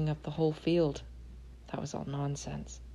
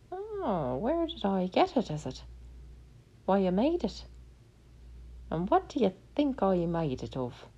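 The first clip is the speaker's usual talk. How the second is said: high little leprechaun voice; 4 semitones higher than usual. Acted. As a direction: play the cheeky little leprechaun, pitched up. Leprechaun teases Tom.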